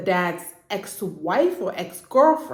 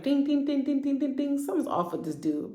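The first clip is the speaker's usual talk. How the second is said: ding ding alarm; 6 semitones higher, 5 dB quieter than usual. Retold or imitated. Reacting to a red flag.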